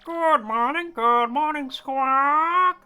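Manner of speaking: high squawky parrot. Acted. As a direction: chirp it like a parrot. Greeting as the parrot.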